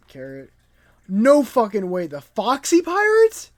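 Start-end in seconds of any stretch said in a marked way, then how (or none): 1.1-3.5 loud yell climbing to a high pitch; real incredulous outburst; shocked disbelief. Real, not acted. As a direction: shout it in astonishment, stretching the last words. Reacting to a surprise cameo.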